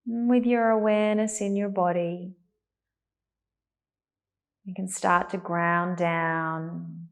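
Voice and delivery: calm, unhurried meditation voice with long pauses; guided meditation. Real, not acted. Teacher settling a class into meditation, calm and spacious. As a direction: ease them gently into stillness, leaving space to breathe.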